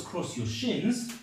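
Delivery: ordinary talk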